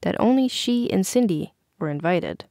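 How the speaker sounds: usual speaking voice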